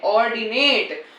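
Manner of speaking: ऑर्डिनेट said slowly, syllable by syllable; careful pronunciation. Shown as a demonstration. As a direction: spell out ऑर्डिनेट slowly and clearly. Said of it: "अब इसका actual pronunciation जो होता है". (ऑर्डिनेट का धीमा, साफ़ उच्चारण)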